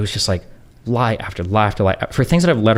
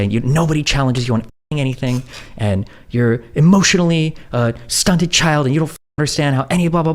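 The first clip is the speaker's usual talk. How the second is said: high-pitched mocking rant, climbing higher as it runs; unhinged Melina; 7 semitones higher than usual. Retelling her attack on him, high unhinged mock rant. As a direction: imitate an unhinged partner piling on accusations. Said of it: "her conversation is, like, unhinged".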